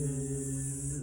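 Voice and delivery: soft buzzing hum like a bee. Shown as a demonstration. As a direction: buzz with the tongue on the roof. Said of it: "you make a buzzing sound like brr".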